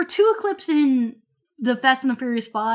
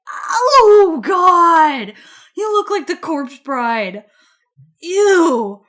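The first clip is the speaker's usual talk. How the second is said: disgusted exclamation; 4 semitones higher, 7 dB louder, much slower than usual. Retold or imitated. Mocking disgust at thin body.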